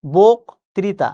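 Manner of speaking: "বক্তৃতা" very slow, loud first syllable, then a gap; slow, split "বক্তৃতা", heavy on the start; slow demonstrated pronunciation. Shown as a demonstration. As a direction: punch the "বক" of "বক্তৃতা", then draw out the rest. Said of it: "আপনি কিন্তু এইরকমই উচ্চারণ করেন".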